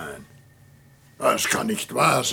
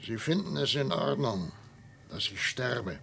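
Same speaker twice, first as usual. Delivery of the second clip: leise Angst; 5 semitones lower, 10 dB quieter than usual. Acted. Todesangst vor der Pistole.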